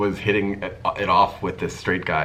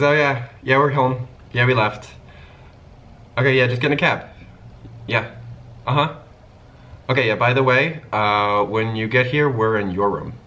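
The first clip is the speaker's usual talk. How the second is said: higher and louder, breezy and casual on the phone; chirpy phone chatter; 6 semitones higher, 5 dB louder than usual. Retold or imitated. Friend on the phone breezily giving directions, higher and louder. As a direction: rattle it off like an easy phone call.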